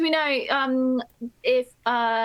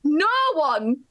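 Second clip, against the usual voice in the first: northern Leeds accent, words drawn out. Shown as a demonstration. Contestant helping teammates with the quote, in a Leeds accent. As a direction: put on a broad Leeds accent. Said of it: "say this word in a Leeds accent".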